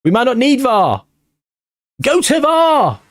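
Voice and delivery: high, slowed shouted demand; excited appeal. Real, not acted. Gamer shouting at the game to check the penalty. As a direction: plead for the review.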